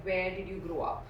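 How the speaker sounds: ordinary delivery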